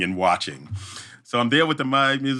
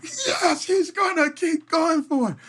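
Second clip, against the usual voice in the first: much higher, shouted cheer; cheering crowd; much slower than usual. Retold or imitated. Crowd at the race cheering the injured man on, high excited shout. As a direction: whoop like a crowd cheering him on. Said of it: "Everybody went".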